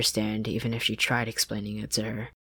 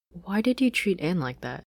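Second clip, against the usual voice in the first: raised, timid question; Marcy asking; 7 semitones higher than usual. Acted. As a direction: ask her friend carefully. Marcy asks Sasha.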